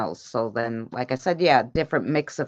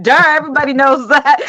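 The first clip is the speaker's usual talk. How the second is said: louder, higher, laughing mock duh; laughing mock scoff; 8 semitones higher, 7 dB louder, faster than usual. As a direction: tease her through a laugh, quick. Mocking daughter, laughing.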